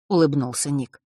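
plain reading voice

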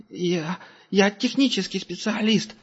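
high, slow, timid angel voice; the captive angel answers the tracker, high and timid; stammering captive. A narrator's reading. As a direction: stutter it out like a cornered coward.

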